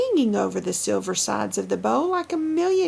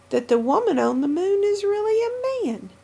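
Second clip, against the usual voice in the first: revealing surprise; 9 semitones higher than usual. A narrator's reading.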